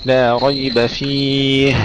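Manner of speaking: فيه slowed, final ه breathed out clearly; clear هاء. Shown as a demonstration. Teacher showing the student the missing ه, slow. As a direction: draw out فيه and let the ه be heard. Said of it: "أين الهاء؟".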